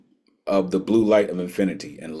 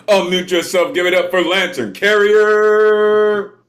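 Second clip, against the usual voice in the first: hype host shout; 13 semitones higher, 10 dB louder than usual. Real, not acted. Host calling out next applause.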